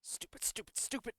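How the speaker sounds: harsh, high-strained whisper; whispered burst of self-reproach; self-berating whisper. Acted. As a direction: scold yourself under your breath. Character berating himself in thought.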